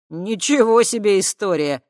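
loud, amazed exclamation; impressed, almost whistling; amazed whistle. A narrator's reading. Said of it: "присвистнул Шел".

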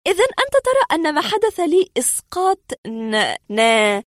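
high woman's voice stumbling at the end; hesitant woman patient. Acted. Patient checks the doctor's term, high and faltering. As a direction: start high, then falter on the unknown word.

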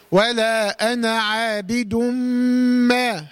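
very slow chant, عابد ما joined as "aabidummmaa"; ghunna held two counts. Shown as a demonstration. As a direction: recite ولا أنا عابد ما, holding a long nasal m before the final vowel. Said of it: "ما تنساش الغنة بمقدار إيه؟".

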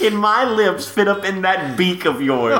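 slow, laughing through a cheesy line; laughing mock seductive. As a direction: ham up the pickup line. Hammed quote, laughing, slow.

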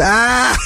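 high yelp of pain; sudden high cry from the hallway; mock scream. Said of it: "Someone going".

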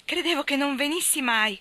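high, loud joyful cry of greeting; excited exclamation. Acted. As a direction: call out to him, thrilled to see him. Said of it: "esclamò Amy".

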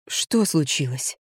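low, slow, soft weary question; tired. A narrator's reading. Friend home from work to narrator, tired. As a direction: drag it out, worn out. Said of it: "устало спросила Катюня".

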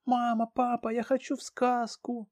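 put-on high child's voice; excited little girl. Daughter Dianka pleads.

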